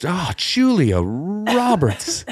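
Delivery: slow, high mock-anguished oath; exclamation. Shown as a demonstration. Showing co-host a new exclamation, slow mock oath. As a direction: cry the name out like a swear word. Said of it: "using that as an exclamation".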